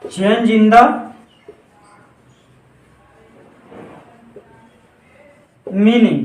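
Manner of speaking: very slow, word by word for note-taking; slow dictation. Shown as a demonstration. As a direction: spell it out slowly so they can write. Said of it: "ऐसे लिखिए". (धीरे-धीरे लिखवाते हुए)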